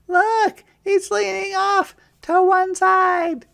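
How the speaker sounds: put-on very high, loud child voices; high excited kids; children crying out. A narrator's reading. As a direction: squeal it like little bunnies. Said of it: "the children cried".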